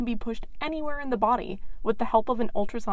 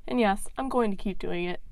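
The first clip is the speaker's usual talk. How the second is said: ordinary delivery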